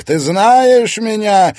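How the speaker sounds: high, loud, stretched-out question; slow, ringing cry. A narrator's reading. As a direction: shout the question, drawing it out. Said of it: "раздался в ответ крик".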